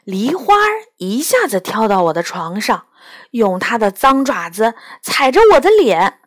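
ordinary delivery

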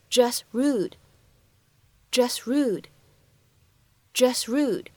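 "just rude" joined, the t of "just" not released; incomplete plosion. Shown as a demonstration. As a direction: glide from "jus" into "rude" without releasing t. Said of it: "会存在一个不完全爆破的处理". (“just rude”中t不完全爆破)

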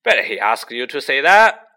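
loud and jeering; loud mocking ghost. A narrator's reading. Headless ghost loudly mocks Nick's praise. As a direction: sneer it out loud. Sir Patrick's head mocking Nick.

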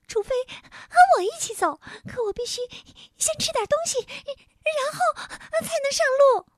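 very high, squeaky and halting; little moon creature. A narrator's reading. Hungry little man bargains with the king, high squeaky voice. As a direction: bargain in a tiny piping voice, in bursts.

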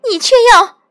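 high, loud hysterical accusation; piercing wail of betrayal; shrill, anguished. A narrator's reading. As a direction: cry out in wounded outrage, building to a scream. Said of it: "玄女厉凄厉道".

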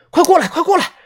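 high, hurried calling out; urgent call. Acted. Narrator acting the panicked call to companions, urgent.

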